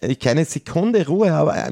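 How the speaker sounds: high, mock-sad whining lament; mock-tragic moaning about the monsters. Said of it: "ich bin so traurig".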